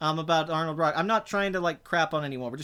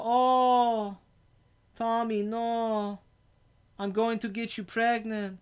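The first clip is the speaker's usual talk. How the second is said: higher, slow, odd Eastern European drawl; Tommy Wiseau impression; 5 semitones higher, much slower than usual. Answering a chat joke with a higher Tommy Wiseau voice. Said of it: "you sound like Tommy Wiseau".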